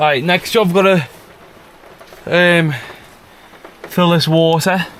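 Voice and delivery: calling out over the weather. Real, not acted. Filling water tank in rain.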